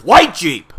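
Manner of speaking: high, loud outburst mid-poem; sudden shout. Acted. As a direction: blurt it out at a shout. Sudden outburst inside a recited poem.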